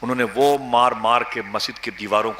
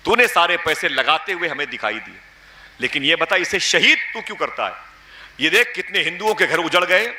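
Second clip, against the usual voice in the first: retold angry reproach, rising higher; threatening scolding; 5 semitones higher than usual. Man rebuking the cleric he once trusted, scolding. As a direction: confront him with indignant, pressing questions. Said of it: "اسے بہت دھمکی دی".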